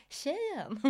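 high, hushed mimicking retort; retold incredulous echo. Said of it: "Och jag bara".

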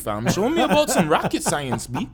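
high, loud exasperated rant; excited rant. Retold or imitated. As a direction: rant it out, pitch way up. Animated rant about school theatrics.

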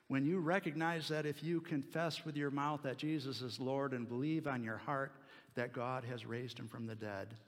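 ordinary talk

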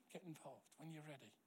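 very soft, no-pressure invitation; hushed, patient reassurance; gentle reassurance. Retold or imitated. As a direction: offer it quietly and tenderly. Pastor gently releasing hurt man from pressure.

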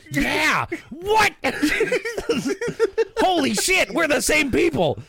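high, laughing shout of delighted recognition; mock excited shout. Irish cheering at meeting the Mexicans, high laughing shout. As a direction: yell it like you just found your long-lost twin, laughing.

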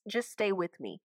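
ordinary talk, nothing marked

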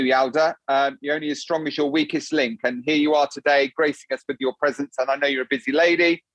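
usual speaking voice

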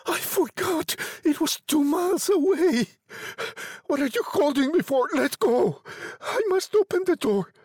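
high-pitched urgent protest; frantic old woman. A narrator's reading. Wife struggling to open the door, frantic high voice. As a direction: burst out high, fighting to get free. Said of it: "struggling automatically".

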